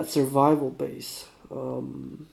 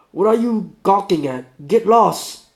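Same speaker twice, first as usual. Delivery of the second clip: put-on irritated Russian accent, higher and louder; 5 semitones higher, 8 dB louder than usual. Sick man telling a staring stranger to get lost, irritated Russian accent. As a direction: snap at a gawker in a Russian accent. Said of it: "do my best, uh, Russian".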